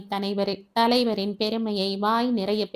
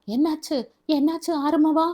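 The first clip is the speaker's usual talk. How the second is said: panicked asking; 7 semitones higher than usual. Acted. Mother and sister running in, panicked call to Arumugam. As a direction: rush in alarmed, calling his name. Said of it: "பதறினார்கள்".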